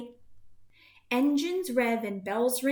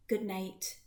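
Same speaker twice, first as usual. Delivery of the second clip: soft good night; 4 semitones lower, 6 dB quieter than usual. A narrator's reading. Bedtime ending of story.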